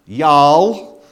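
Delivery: loud drawn Southern "Y'all". Shown as a demonstration. Preacher showing the plural you in an American Southern accent. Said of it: "an American Southern accent type of you all".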